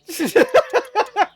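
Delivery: loud high cackling laugh; loud, high-pitched giggling fit; burst of laughter. Real, not acted. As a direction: crack up in a high staccato laugh. Laughs at Fosters remark.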